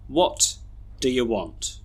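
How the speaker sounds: "do you" reduced to a quick duh-yuh; schwa weak forms. Shown as a demonstration. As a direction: pause after "What", then weak "do you". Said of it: "we should read these sentences like this".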